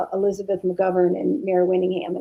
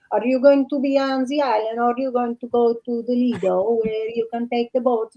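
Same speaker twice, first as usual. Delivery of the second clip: higher-pitched, hammed-up Italian accent; bad Italian accent; 4 semitones higher than usual. Asking where she will go in Italy, mock Italian accent. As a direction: play at a terrible Italian accent. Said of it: "My horrible Italian bad accent".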